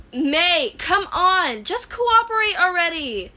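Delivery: loud, high yell through a door. Acted. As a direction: shout at her to cooperate. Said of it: "Izuku yelled from outside".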